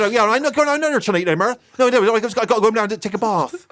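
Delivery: high, fast West Country babble with one posh "bath"; exaggerated Bristol accent. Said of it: "I still sounded like me".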